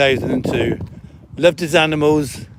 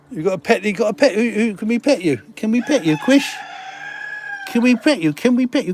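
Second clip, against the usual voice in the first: much higher pet-talk voice; talking to kittens; 6 semitones higher than usual. Real, not acted. Farmer coos at the kittens, high and sing-song. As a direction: coo at the kittens like babies.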